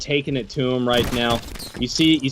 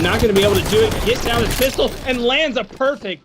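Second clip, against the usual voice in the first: excited caster; 9 semitones higher, faster than usual. Real, not acted. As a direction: get hyped at the play. Caster calling a pistol kill.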